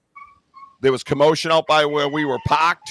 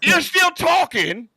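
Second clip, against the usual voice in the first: high, strained shouting over someone; mock furious yelling. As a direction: yell in hammed-up exasperation. Acted quarrel, yells at the talker.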